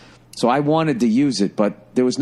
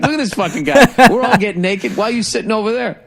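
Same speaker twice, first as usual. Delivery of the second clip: high, louder, laughing voice of the onlookers; laughing mock quote; 9 semitones higher, 4 dB louder than usual. Teller imagines the naked sauna crowd mocking him, laughing. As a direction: blurt their imagined question while laughing.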